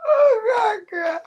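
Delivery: high, breathless-with-laughter exclamation; real squealing laughter; laughing to tears. Real, not acted. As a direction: wail it through laughter. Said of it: "let me stop laughing".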